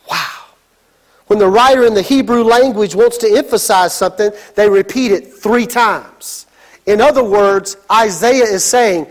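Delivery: ordinary talk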